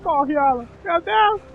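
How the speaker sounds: high, loud frantic urging; panicking gamer. Real, not acted. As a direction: yell at the character in panic. Character chased by monsters.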